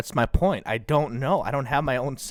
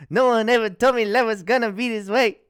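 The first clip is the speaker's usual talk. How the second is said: silly character voice; 9 semitones higher, 4 dB louder than usual. Acted. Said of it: "give me a character".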